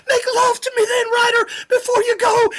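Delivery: very high, tearful hammed-up begging; hammed-up crying woman; mock sobbing plea. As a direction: fake a weepy lover's plea. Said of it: "and she says".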